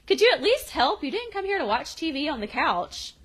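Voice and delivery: high, exasperated mock scolding; retold fed-up complaint; annoyed scolding. Voicing annoyed girl scolding friend.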